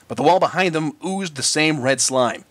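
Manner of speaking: ordinary delivery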